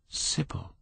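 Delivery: whispered cry of her name; anguished breath. Acted. Dorian breathes Sybil's name, a whispered anguished cry.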